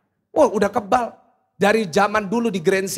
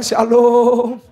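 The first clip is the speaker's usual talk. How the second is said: trembling shalom; 6 dB louder than usual. Retold or imitated. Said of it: "Saya bilang".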